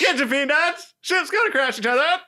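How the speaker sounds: high, loud hawker's cry; peanut vendor call. Retold or imitated. Vendor hawking peanuts to crash watchers, high loud call. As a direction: sell peanuts to the crowd.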